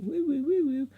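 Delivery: high whooping drone noise; high-pitched "woo" whirr; imitated drone. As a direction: mimic a drone with high woos.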